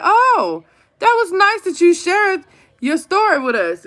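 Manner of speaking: high, sweet mock-teacher praise; sugary teacher voice. Mother retelling how the teacher praised her son, sugary voice. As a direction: gush like an overly nice teacher. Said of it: "She was like".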